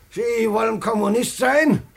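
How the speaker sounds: usual speaking voice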